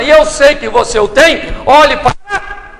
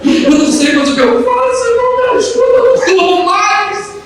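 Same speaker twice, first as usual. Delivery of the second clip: high-pitched mock wailing; imitated crying; 8 semitones higher than usual. Church sister moaning about the chicken, imitated high tearful wail. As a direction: wail it out much higher, drawing out the end. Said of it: "e ela chorando".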